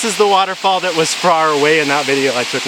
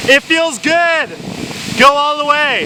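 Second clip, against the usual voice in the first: high excited shouts to a friend; shouting; 11 semitones higher than usual. Real, not acted. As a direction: call out across the falls.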